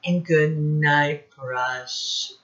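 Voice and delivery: loud, sing-song storybook reading; reading to children. Acted. Teacher reading Goodnight Moon aloud, sing-song. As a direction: read each goodnight out to the class. Reading picture book to class.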